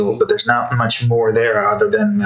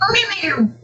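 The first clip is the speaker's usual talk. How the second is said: squeaky high cat meows; a cat voice pitched far up. Pretend. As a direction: meow like a tiny kitten. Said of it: "the meow voice".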